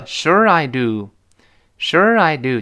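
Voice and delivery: slow reply with "Sure" drawn out and stressed. Shown as a demonstration. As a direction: lean on "Sure", let "I do" fall. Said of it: "时而中毒".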